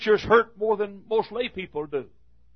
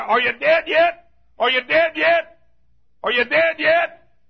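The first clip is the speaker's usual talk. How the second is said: higher, louder call repeated; calling down into a grave; 7 semitones higher, 4 dB louder than usual. Acted. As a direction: call down to the buried monk.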